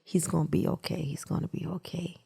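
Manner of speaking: quiet self-convincing. Retold or imitated. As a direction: murmur it to convince yourself. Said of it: "I just had to keep convincing myself".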